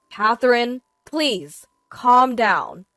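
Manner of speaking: loud, pleading interruption; urgent pleading. A narrator's reading. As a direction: beg your angry wife to stop. Husband begs wife to calm.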